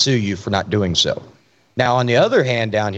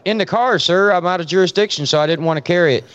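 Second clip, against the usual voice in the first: higher, eager "yes sir" voice; nervous rookie; 6 semitones higher than usual. Retold or imitated. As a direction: answer the senior officer like a startled rookie. Rookie answering angry senior deputy.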